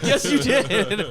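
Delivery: high, loud retort breaking into a laugh; a shouted retort to a brother dissolving into laughter; laughing mock yell. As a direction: shout it back while cracking up. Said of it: "screaming at him".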